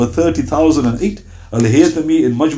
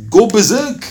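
playful urging; 7 semitones higher, slower than usual. Retold or imitated. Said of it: "he goes".

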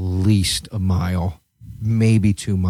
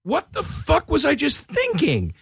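high, slow panicked voice; a stunned squeaky whine; mock panic; 3 dB quieter, slower than usual. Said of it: "I'm just like".

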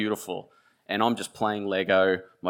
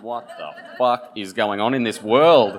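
mind blown; 4 semitones higher, 11 dB louder than usual. Retold or imitated. Kid's mind blown by secret room.

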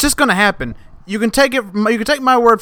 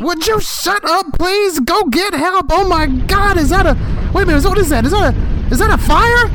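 high frantic shouts; panicked yelling; 10 semitones higher than usual. Acted. Skit handyman panicking at a fire, high and frantic. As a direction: scream at him to go get help.

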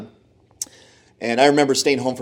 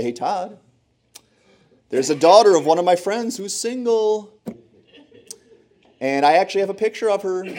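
eager mom voice; 7 semitones higher than usual. Retold or imitated. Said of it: "come back and say".

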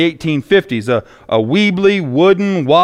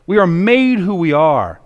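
ordinary talk

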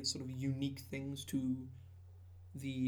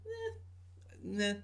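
high noncommittal noises; so-so, pitched well up; unimpressed; 6 semitones higher, 3 dB quieter than usual. Real, not acted. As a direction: squeak out a lukewarm verdict. Lukewarm verdict on the subclass.

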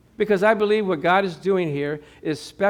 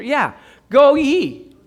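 solemn calling; 7 semitones higher, 6 dB louder, much slower than usual. Retold or imitated. Quoting his calling.

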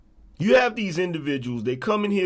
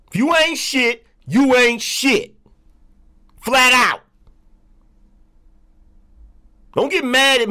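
loud, higher, hammering scorn; angry rant; 5 semitones higher, 5 dB louder than usual. Real, not acted. Streamer shouting down a hater in his chat, loud and furious. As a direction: yell each insult, pounding it home. Streamer ranting at anonymous trolls.

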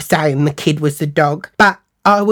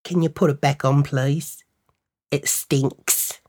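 low-key, softer put-down; understated, quiet insult; deadpan request; 3 semitones lower, 6 dB quieter, slower than usual. Retold or imitated. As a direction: drop the volume and say it dryly. Deadpan insult after her screaming.

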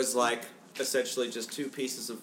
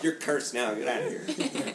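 mock angry ghost; 5 semitones higher, 4 dB louder than usual.

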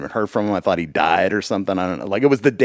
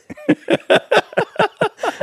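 high laugh. Real, not acted. Laughing at own joke.

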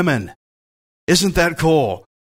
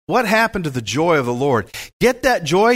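usual speaking voice

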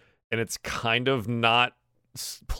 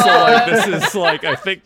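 laughing; 8 semitones higher, 11 dB louder than usual. Real, not acted. DM laughing at drunk players.

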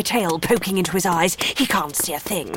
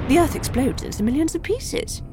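usual speaking voice

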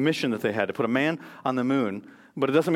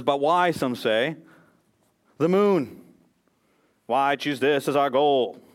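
put-on Boston accent, declaimed firmly and deliberately; measured speech in an imitated Boston accent; JFK; 3 dB louder, slower than usual. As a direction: give a Boston-accented presidential oration. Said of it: "That's my last Boston accent".

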